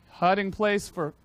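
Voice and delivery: ordinary delivery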